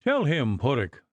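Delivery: ordinary delivery, nothing marked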